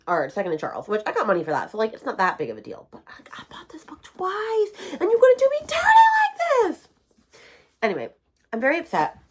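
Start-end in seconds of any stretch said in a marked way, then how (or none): 2.9-4.7 higher, whispered, slow. Real, not acted. Reader whispering to viewers about buying the book twice, disbelieving. Building to outrage. 4.9-6.9 much higher, loud shout; outraged shout. Real, not acted. Reader shouting at the book for letting her down, outraged.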